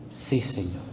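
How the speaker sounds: soft, low, submissive answer; small hushed reply; meek assent. Acted. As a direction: answer quietly and humbly, dropping low. Acts the believer's meek answer.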